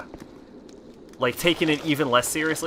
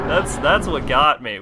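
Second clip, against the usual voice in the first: usual speaking voice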